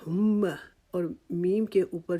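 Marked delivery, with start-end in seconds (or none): 0.1-0.4 ہم with the ہ pushed out like a groan of pain; pained h sound. Shown as a demonstration. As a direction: breathe ہ as if hurting. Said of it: "جیسے کہ انسان تکلیف کے ساتھ آواز نکالتا ہے". (تکلیف والی آواز سے ہ)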